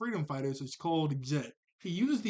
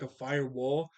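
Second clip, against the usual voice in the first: ordinary talk, nothing marked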